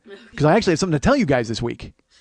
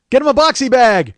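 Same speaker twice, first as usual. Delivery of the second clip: loud, high barked order; hammed-up dojo yell; mock sensei shout; 7 semitones higher, 9 dB louder than usual. Sensei from Karate Kid ordering a student, shouting.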